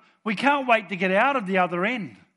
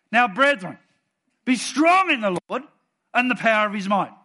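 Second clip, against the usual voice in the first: loud, high, commanding proclamation; bold declaration; 5 semitones higher, 7 dB louder than usual. Shown as a demonstration. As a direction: proclaim it boldly, with full force. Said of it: "let me say it the way that I think he meant it".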